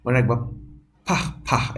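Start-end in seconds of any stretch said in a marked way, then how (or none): 1.1-1.8 "ফাহ" as unvoiced puffs of air; p mixed with ph; aspiration demo. Shown as a demonstration. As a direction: burst air out on each "ফাহ". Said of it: "পর ফ মিশ্রিত".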